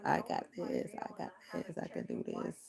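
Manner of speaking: whispered self encouragement through the pain; whispered self pep talk. Real, not acted. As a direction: whisper it to yourself. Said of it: "I will not bend".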